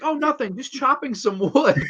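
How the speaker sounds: high, mock-innocent "just chopping some wood"; innocent Ethel. Voicing Ethel holding a cleaver.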